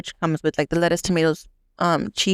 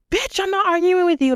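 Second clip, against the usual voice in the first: sharp comeback; 11 semitones higher than usual. Retold or imitated. Snapping back at arguing customer.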